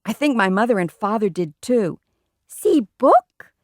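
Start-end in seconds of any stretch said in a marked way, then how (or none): 2.5-3.5 put-on high, loud little-kid voice; eager toddler. A narrator's reading. Toddler shows the guests his book, eager and high.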